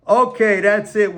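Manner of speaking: mock fed-up announcement; acted defiant declaration. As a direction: announce it like you've had enough, imitating. Acting out Newsom's announced defiance.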